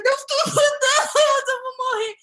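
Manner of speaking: high mock-crying wail; hammed-up panic. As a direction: cry out as if doomed, overdramatically. Retells panic during the exam.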